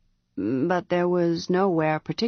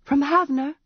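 surprised; 7 semitones higher than usual. A narrator's reading. Priestess surprised.

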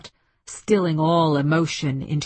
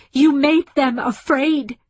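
high, loud, slow shout; shouted accusation; 9 semitones higher, 6 dB louder, slower than usual. A narrator's reading. Woman in tears confronting the aliens, shouting. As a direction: shout the blame at them. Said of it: "she shouted".

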